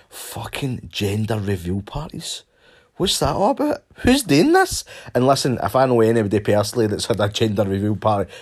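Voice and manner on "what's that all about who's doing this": high, loud disbelief; incredulous outburst. Real, not acted. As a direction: shriek in outrage at it.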